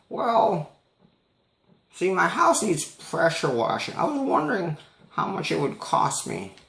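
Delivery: put-on high old lady voice; imitating the old lady's quavery ask; high-pitched impression of an elderly woman.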